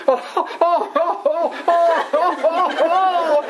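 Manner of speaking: high, long oh-oh laughs that sound like crying; laughing like sobbing. Retold or imitated. Coworker on the prank call laughs so it sounds like crying. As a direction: fake sobs that are really giggles. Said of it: "he just going".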